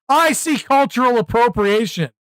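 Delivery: "I see cultural appropriation" as a falling mock-grave declaration; mock verdict. Streamer mocking outrage culture, mock-solemn verdict. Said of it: "Do I see cultural appropriation".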